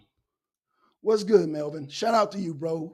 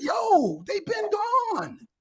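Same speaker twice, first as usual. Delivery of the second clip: much higher, slow, drawn-out shout; exasperated yell; 12 semitones higher, much slower than usual. Retold or imitated. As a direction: stretch it out like you've had enough. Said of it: "I'm just like".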